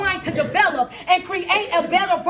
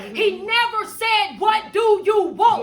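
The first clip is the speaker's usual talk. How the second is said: higher-pitched, driving sermon delivery; fervent preaching; 4 semitones higher than usual. Real, not acted. Coach preaching that life requires a brand new you, fervent.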